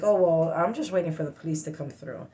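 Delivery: ordinary talk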